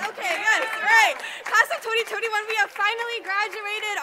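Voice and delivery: thrilled and loud; excited. Real, not acted. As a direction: celebrate like you just graduated. Celebrating graduation.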